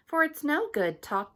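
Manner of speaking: ordinary delivery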